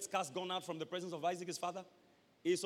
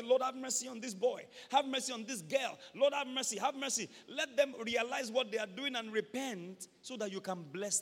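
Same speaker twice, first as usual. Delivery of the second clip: fervent pleading prayer; 4 semitones higher than usual. Shown as a demonstration. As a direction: plead with God, rising. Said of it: "I go to God and say".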